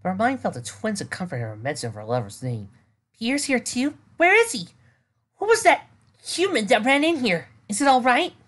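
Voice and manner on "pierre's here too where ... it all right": high, urgent, worried questions; anxious. Acted. Worried character voice.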